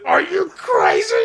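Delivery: high, loud, drawn-out cartoon cry; acted outburst. Creator re-enacting his cartoon's line, high hammed-up outburst.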